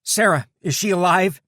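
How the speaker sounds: high, loud desperate shout; frantic yell. A narrator's reading. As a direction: scream her name out in panic. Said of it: "Kenny yelled".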